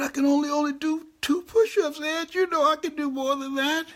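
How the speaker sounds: high-pitched tearful whine, re-enacted; mock crying. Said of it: "You sound like you're crying".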